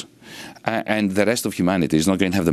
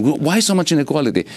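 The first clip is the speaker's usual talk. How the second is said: put-on raised pitch, a child's puzzled question; imitating young daughter; 8 semitones higher than usual. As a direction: lightly mimic a kid asking why. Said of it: "the question".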